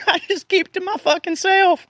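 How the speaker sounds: hammed-up high-pitched repeat; mock squeaky. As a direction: squeak it out like a cartoon. Repeats his line in a put-on voice.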